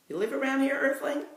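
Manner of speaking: a high, playful copy of Earthling; playful mock echo. A narrator's reading. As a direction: toss his word back at him. Max teases back with Earthling.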